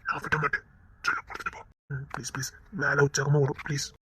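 soft breathy coaxing, barely voiced; whispered pleading. Husband whispering to wife to coax a favour, hushed.

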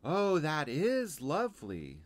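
put-on high, slow admiring child; Lucy. Girl admiring the ironed frills, high and slow. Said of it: "said Lucy".

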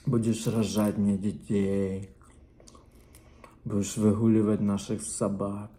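ordinary delivery

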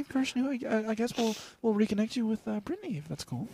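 high, soft, hesitant operator voice; Ashley. Acted. Phone-line worker passing caller on, high and soft. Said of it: "you're connecting with Ashley".

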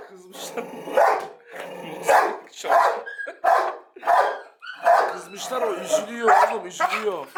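gentle high dog-talk. Real, not acted. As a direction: tenderly soothe the worried dog. Baby talk to upset dog.

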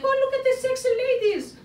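hammed-up soldier ogling, rushed; leering soldiers. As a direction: soldiers ogling the Amazon women, quick and high. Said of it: "the good soldiers are like".

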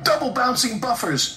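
high-pitched frightened exclamation; shriek. A narrator's reading. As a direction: shriek in alarm, without much volume. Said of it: "shrieked Percy".

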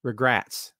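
"regrets" said as "re-GRATS"; misspelled regrets. Shown as a demonstration. Correcting a viewer's movie quote, "regrets" as "regrats". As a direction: say "regrets" with the vowel of "rats". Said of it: "R E G R A T S".

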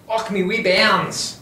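hammed-up Scottish accent. Shown as a demonstration. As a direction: lay on a thick Scottish brogue. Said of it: "I can work on my Scottish accent".

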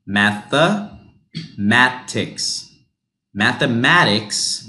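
mathematics said slowly, math-uh-matics; slow model word. Shown as a demonstration. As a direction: stretch mathematics out. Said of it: "this becomes a U H sound".

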